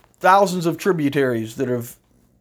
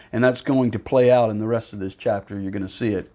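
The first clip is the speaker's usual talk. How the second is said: usual speaking voice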